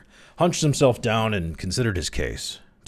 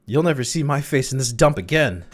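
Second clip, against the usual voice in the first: high, louder angry retort; mildly savage, thrown over the shoulder; savage parting shot; 9 semitones higher, 5 dB louder than usual. A narrator's reading. Crawley leaves the cafeteria beaten, savage parting retort. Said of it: "muttered Crowley savagely over his shoulder".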